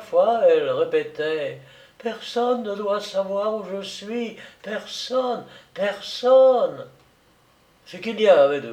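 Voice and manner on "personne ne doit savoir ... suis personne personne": feverish insistence. Acted. Feverish woman repeating a plea.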